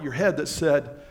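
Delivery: ordinary talk, nothing marked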